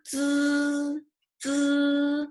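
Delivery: "zi" as a long, high, level buzzed dz; closed-teeth zi. Shown as a demonstration. Teacher having students feel their teeth meet, closed-teeth zi. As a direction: buzz "zi" evenly through closed teeth. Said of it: "teeth can be, like, closed".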